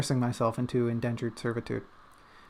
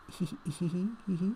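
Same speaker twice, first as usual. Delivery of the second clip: impish giggle; 6 semitones higher, 6 dB quieter than usual. Acted. Impish giggle after teasing.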